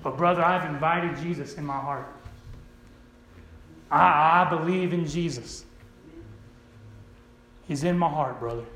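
low, subdued mock profession; imitating uncaring believer. Preacher mimics believers who don't care, low and half-hearted. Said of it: "they don't even care".

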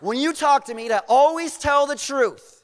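high, loud, exasperated complaint; mad king. Retold or imitated. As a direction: scold him, voice pushed up and loud. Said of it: "gets mad".

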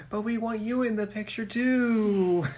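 high-pitched mock whine to a teacher; pleading students.

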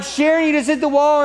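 high, loud retold panic yell; panicked shout. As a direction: yell her name as the car hits the wall. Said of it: "And I'm like".

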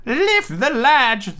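put-on high, slow, loud old-lady call; old grandmother voice. Grandmother calls out.

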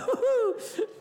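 high-pitched laugh; real laugh. Real, not acted. Preacher tickled by his own joke, high laugh. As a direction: break up laughing. Joking with congregation.

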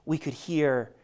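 usual speaking voice

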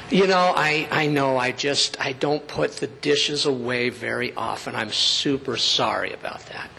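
soft, low, humble apology; acted meek admission; conciliatory apology. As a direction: own up gently, voice dropping. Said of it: "the one that says".

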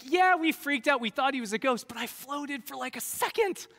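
high, loud, hammed-up bragging; Peter. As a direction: play an indignant boaster defending his brief moment of glory. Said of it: "If I'm Peter and I'm telling this story".

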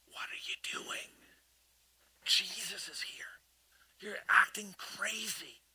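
whispered, urgent and slow; hushed rebuke. Retold or imitated. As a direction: hiss a warning at the sister. Mary's hushed scolding thought.

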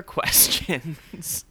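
ordinary talk

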